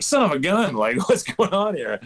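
high-pitched comic indignation; mock exasperation. Umpire reacting to an email about expiring stars, high mock exasperation. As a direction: act out baffled outrage, voice leaping up.

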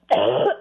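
sudden harsh cough; hacking cough; coughing fit. Real, not acted. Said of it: "I'm so sorry about your, your throat".

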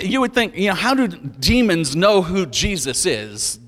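ordinary delivery

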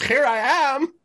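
high grand heroic boast; mock heroic Zorro. Quoting the Zorro shirt, hammed-up heroic boast. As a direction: proclaim it like a proud masked hero. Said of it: "a shirt that just has Zorro that says".

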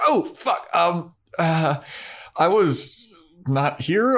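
mock panic when caught sneaking in; caught off guard. Buffy caught by Giles and Willow, high and flustered.

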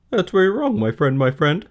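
high, loud honking goose voice; high, loud cackling goose. Acted. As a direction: crow it like a bossy goose. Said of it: "said a voice".

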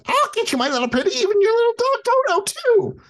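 very high, shrieking witch voice; high screeching witch threat; Wicked Witch cackle voice. Retold or imitated. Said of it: "Margaret Hamilton, the Wicked Witch of the West".

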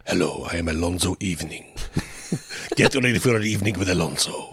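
low, soft seductive Latin lover voice; purring latin lover. Retold or imitated. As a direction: purr like a smooth Latin lover. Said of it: "Latin lover type".

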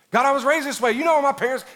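loud, high mock pleading; acted protest. Believer blaming upbringing before God, loudly protesting. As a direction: protest as if pushing the excuse harder.

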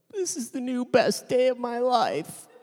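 high, slow, teary mock sob of joy; a hammed-up weepy wife overcome with happiness; imitating crying wife. Retelling his wife's tearful words, high and slow. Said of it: "she's crying".

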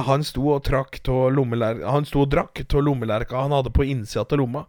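usual speaking voice

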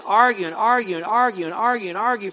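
insistent, repetitive singsong beat; relentless arguing. Shown as a demonstration. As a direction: hammer out each repeat. Mimics endless, relentless arguing.

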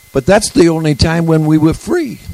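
put-on a touch of a French accent. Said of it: "with a French accent".

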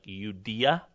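"Judea" begun with a Y sound, rising at the end; Roman pronunciation. Shown as a demonstration. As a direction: say "Judea" as you-DEE-a, ending upward. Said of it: "or with a Y sound".

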